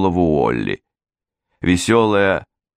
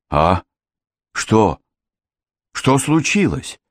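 mildly dazed, stunned questions, each set apart; a startled man muttering, lightly acted; bewildered mumble; 3 semitones higher, slower than usual. A narrator's reading. Mr Jones, stunned by the gunshot, mumbling in bewilderment. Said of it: "забормотал мистер Джонс".

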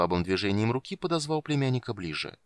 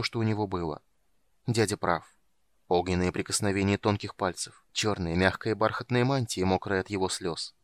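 usual speaking voice

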